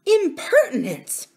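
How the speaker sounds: high, slow affronted exclamation; indignant nurse. A narrator's reading. Nurse affronted by the miner boy, indignant. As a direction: draw it out in outrage. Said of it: "murmured the nurse".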